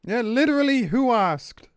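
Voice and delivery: mock squeaky voice, high and slow, ranting at the developers. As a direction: sneer it in a silly high voice at the company. Mocking rant after company statement.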